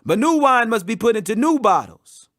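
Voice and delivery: usual speaking voice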